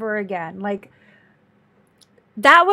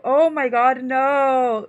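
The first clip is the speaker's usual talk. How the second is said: ordinary delivery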